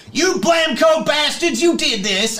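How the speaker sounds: shouting across. Retold or imitated. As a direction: hurl the blame across the cafeteria. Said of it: "shouting across".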